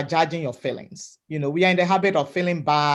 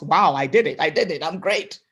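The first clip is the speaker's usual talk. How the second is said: self-praise; 4 dB louder than usual. Shown as a demonstration. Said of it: "Praise yourself".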